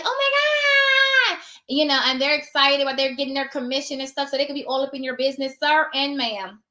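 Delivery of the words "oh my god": very high, slow mock squeal; squealing excitement. Imitating an excited realtor at closing, mock squeal. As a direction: gush like an overexcited realtor. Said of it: "They're like".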